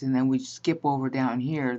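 ordinary talk, nothing marked

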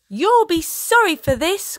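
put-on a high, loud, defiant girl's cry. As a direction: shout it back at the pirates. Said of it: "cried Molly".

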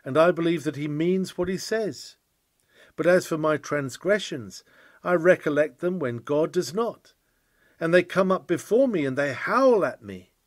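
ordinary talk, nothing marked

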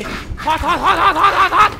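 high, loud rapid-fire yelps; frantic yelping. Real, not acted. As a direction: yelp it as if scalded. Burned in game.

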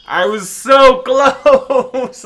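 loud, stretched, laughing wail; laughing in disbelief. Real, not acted. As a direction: laugh through the words, gutted. Died just before leveling up.

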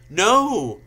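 loud, high-pitched cry of fear; scared shout. A narrator's reading. Narrator's heroine confronted by Voldemort, scared shout. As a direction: shout it out in mock terror. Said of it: "I shouted in a scared voice".

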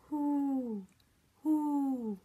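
long, breathy owl hoots; drawn-out owl call; owl hooting. Shown as a demonstration. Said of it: "An owl says".

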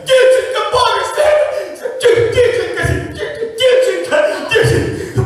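very high, loud, stammering joy; ecstatic shouting. Acted. Father shouting with laughing joy about his daughter. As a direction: choke on delight, laughing. Overjoyed father, girl born.

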